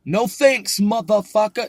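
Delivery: loud, high mock-rude refusal; jokingly impolite. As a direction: blurt it rudely for laughs. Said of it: "No, I'm joking. That would be totally impolite".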